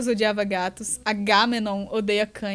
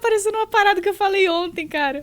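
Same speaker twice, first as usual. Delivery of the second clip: high, helpless real laughing fit; 11 semitones higher, 6 dB louder, faster than usual. Real, not acted. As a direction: squeal the words through real laughter. Cracks up at slip of tongue.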